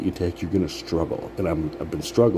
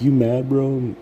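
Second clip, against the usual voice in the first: mocking taunt; 6 semitones higher, 3 dB louder, slower than usual.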